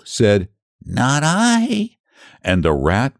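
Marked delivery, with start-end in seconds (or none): 0.9-2.0 much higher meowing no; cat with a meow. Acted. Cat mews a refusal to the hen, high voice. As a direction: mew it like a cat. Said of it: "with a meow".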